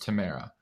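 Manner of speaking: Tamara said softly as tuh-MAIR-uh; name pronunciation shown. Shown as a demonstration.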